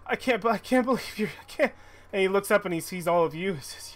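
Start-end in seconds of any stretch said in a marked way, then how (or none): 0.1-1.7 high, choked-up voice of a weeping father; father reunited with his grown daughter, high and tearful; overwhelmed old father. Acted. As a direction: gasp it out high, overcome with joy.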